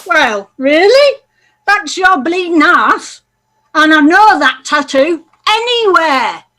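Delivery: angry. Acted. Wife confronts lover angrily.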